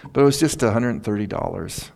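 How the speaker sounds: ordinary delivery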